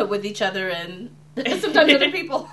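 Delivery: giggling through the words; breaking into giggles mid-sentence; laughing. Real, not acted. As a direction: laugh while you get it out. Twins laughing through audition pitch.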